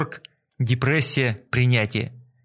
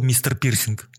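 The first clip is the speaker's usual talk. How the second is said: usual speaking voice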